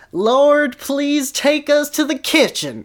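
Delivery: high, loud, slow preacher bellow; bellowed prayer. Acted. Dumbledore praying loudly to be taken to the kitchen. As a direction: boom it up to the heavens. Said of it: "Dumbledore bellowed in a voice".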